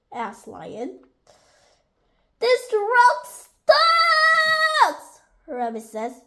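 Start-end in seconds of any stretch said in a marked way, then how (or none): 2.4-5.2 very high squealed shout; shouted exclamation. A narrator's reading. Rabbit startled by the talking rock, high squealed shout. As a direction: squeal the last word long and loud.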